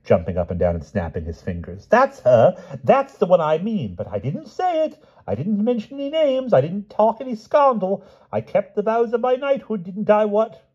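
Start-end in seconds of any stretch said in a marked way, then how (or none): 1.9-10.6 very high, excited, loud gush; delighted babble. A narrator's reading. Pellinore triumphantly defending his discretion, high and excited. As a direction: gabble it out in glee. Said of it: "King Pellinore was delighted".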